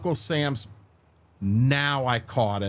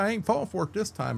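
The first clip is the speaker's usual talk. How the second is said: usual speaking voice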